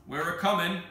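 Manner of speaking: loud, high answering call; shouting back. Acted. Andrew answers the tower, loud call. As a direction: holler a reply up to them.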